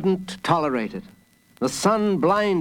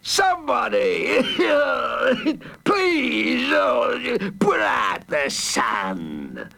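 villain's anguished shout; 8 semitones higher, much slower than usual. A narrator's reading. The Hooded Fang begging for relief from sunlight, wailing. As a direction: wail and plead, dragging out the final words. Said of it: "shouted the Hooded Fang".

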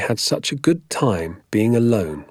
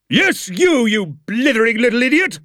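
high, loud teacher's tirade; irate, high-pitched scolding shout; furious shouting; 4 dB louder than usual. A narrator's reading. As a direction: yell the insult like a drill sergeant. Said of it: "He was shouting at me".

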